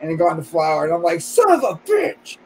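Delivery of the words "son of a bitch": shouted high and frustrated; angry outburst. Retold or imitated. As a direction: yell it in frustration.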